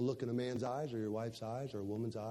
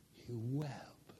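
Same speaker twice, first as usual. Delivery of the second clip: hushed whisper, much softer; 3 semitones lower, 9 dB quieter than usual. Shown as a demonstration. Pastor repeating that Peter wept, in a whisper. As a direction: breathe it out as a whisper.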